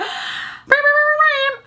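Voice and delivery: sharp, very high excited gasp. Retold or imitated. Buyer seeing the jacket bag, high delighted gasp.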